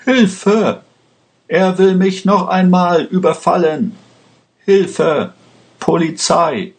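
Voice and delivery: very high-pitched cries for help; panicked, high call for rescue; horrified cry. A narrator's reading. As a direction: cry out in acted terror. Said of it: "begann entsetzt zu schreien".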